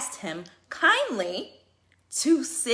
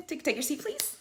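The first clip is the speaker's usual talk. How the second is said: sugary fake-polite order, rising high on please; mock sweet but firm; sarcastic politeness; 5 semitones higher, 5 dB quieter than usual. Flight attendant to a seat-stealing passenger, sarcastically polite. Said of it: "I'm saying it just like that".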